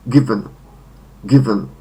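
GIVen, short e in the second syllable; first-syllable stress. Shown as a demonstration. Teacher models the third form, given stressed up front. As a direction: stress "giv" in given, let the ending fall. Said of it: "Akcent na pierwszą sylabę".

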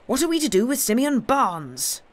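high, loud, urgent question; feverish cry. A narrator's reading. Gibbs pressing the group about the blacksmith, feverish. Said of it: "cried Gibbs in a fever".

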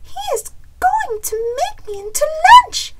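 very high, loud panicked hen; gasping chicken. A narrator's reading. Chicken realizes she may be lunch, high squealing panic. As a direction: squeal it in storybook alarm. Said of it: "Chicken gasped".

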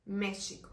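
"México" with the x said as "sh": "Méshico". Shown as a demonstration. Teacher showing how Portuguese speakers say "México" with sh. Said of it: "la X suena como una sh".

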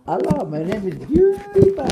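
high-pitched mock intro; PewDiePie. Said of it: "Say PewDiePie this time".

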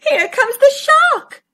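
high-pitched frightened cry; terrified turtles. Acted. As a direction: raise the alarm in terror. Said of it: "The turtles were terrified".

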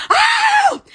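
high, loud delighted "Oh!"; thrilled yelp; excited squeal. Real, not acted.

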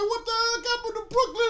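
very high, loud comic outburst; mock bewildered cry. [name] looks completely lost in Brooklyn, mock bewildered cry.

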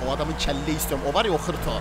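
ordinary delivery

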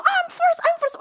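very high, loud insistence; mock clamoring. Impatient guests insist to the hostess, high loud clamor. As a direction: push to the front of the line.

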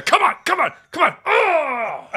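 high, loud pretend cheering that ends in a groan; hammed-up sports-fan outburst; mock rooting. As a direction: cheer the runners on, then cry out in mock dismay. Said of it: "rooting for it?".

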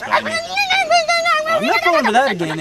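high, loud, slow indignant squeal; an indignant shopkeeper squeaks at the customer; squeaky outburst. Acted. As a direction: rise into a squeaky, drawn-out protest.

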